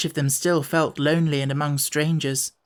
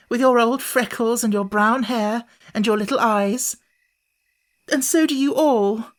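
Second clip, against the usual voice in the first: put-on relieved girl, almost crying for joy; joyful near tears; 8 semitones higher than usual. A narrator's reading. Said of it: "almost in tears for joy".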